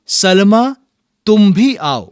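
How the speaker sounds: high, loud call to a student. Shown as a demonstration. Teacher models a command for the class to repeat, calling out loudly. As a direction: summon her across the room. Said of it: "अब मैं कुछ वाक्य बोलूंगा".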